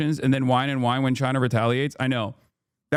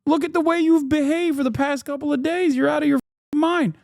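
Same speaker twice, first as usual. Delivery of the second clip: mock furious retort; 12 semitones higher than usual. Streamer's imagined retort to haters, high and shouting. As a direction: blast back at them, incredulous and fed up. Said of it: "It's like".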